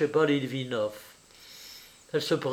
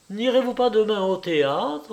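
high, openly audible small talk; raised voice; 7 semitones higher than usual. A narrator's reading. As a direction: say it aloud for the next room. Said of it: "reprit-elle à haute voix". (à voix haute, ton mondain)

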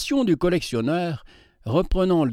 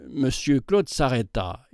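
ordinary delivery

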